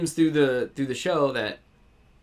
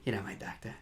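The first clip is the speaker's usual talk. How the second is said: put-on low, soft New York accent; murmured New York line, R dropped from "doctor"; Brooklyn accent; 4 semitones lower, 13 dB quieter, faster than usual. Host re-enacts the cabbie line they noticed, Brooklyn accent. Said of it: "You and Mariah got the accents".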